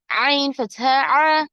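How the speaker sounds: ordinary talk, nothing marked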